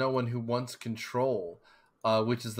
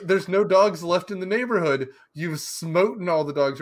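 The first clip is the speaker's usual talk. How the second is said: put-on higher and louder kid voice, a touch eerie; louder, higher boy's voice; voicing Anthony; 5 semitones higher, 7 dB louder than usual. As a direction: play the creepy kid telling off his dad. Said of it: "'Cause he goes".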